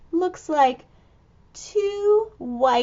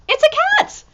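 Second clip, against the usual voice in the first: high, loud delighted gasp of a reveal; spring the surprise; surprised reveal; 4 dB louder, much faster than usual. Acted. Reader to children, high surprised reveal.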